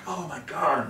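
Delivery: high-pitched dismayed exclamation; acted alarm. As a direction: gasp it in mock horror. Voices his alarm in the dream.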